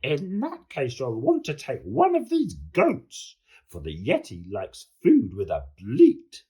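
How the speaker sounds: monster voice. A narrator's reading. Monster Hetty replies to George.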